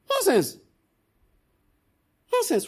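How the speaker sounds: high, sharp scornful cry; angry dismissal. Real, not acted. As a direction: spit out the word in anger. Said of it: "I'm getting angry".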